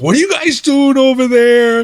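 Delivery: high, loud mock-outraged question; retold confrontation. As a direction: imitate someone calling across to demand answers. Retold caller confronting the team.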